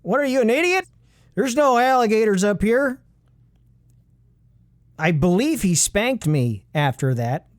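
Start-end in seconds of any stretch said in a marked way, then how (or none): 0.0-3.0 retold yell. Grandpa yelling at his grandson, higher mock yell. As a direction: snap it at the kid like a cranky grandpa, higher. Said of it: "he yelled at me".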